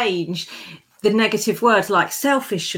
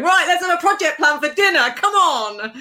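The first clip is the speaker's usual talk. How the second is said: a higher, bossy project-manager voice, half-laughing; hammed-up boss barking orders at dinner; mock bossy manager; 8 semitones higher than usual. Woman mocking herself running dinner like a project, high and bossy.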